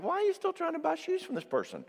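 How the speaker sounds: high-pitched incredulous question; exasperated disbelief. Retold or imitated. Counselor to spouse still begging, exasperated disbelief.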